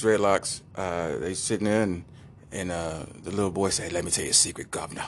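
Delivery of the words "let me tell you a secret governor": low, soft mock-English kid; quiet, sly English-accented aside. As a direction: confide in a put-on English accent. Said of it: "I wanna give him an English accent".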